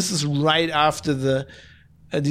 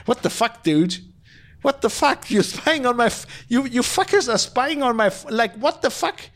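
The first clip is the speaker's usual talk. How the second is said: higher, exasperated what-the-fuck rant, played up; indignant mock phone rant, pitched up; mock outraged Macron; 6 semitones higher than usual. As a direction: play the furious French president ringing Bennett to complain. Macron angrily phoning Bennett.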